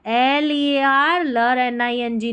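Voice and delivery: ordinary talk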